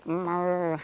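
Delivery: soft, low drawn-out moo; mooing like a cow. Retold or imitated. Rider imitates the cow for her horse, soft low moo. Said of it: "See the moo".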